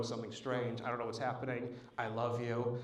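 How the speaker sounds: low, very soft phone goodbye; quiet, subdued last words; hushed farewell call. Retold or imitated. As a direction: imitate a nervous passenger calling family. Nervous passengers phoning their families.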